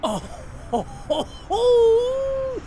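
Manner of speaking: rising ho-ho-ho that peaks high; gleeful teasing chuckle; mocking laugh. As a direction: laugh at the boss's expense. Teasing the boss.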